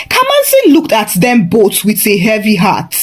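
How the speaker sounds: ordinary delivery, nothing marked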